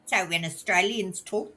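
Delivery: ordinary delivery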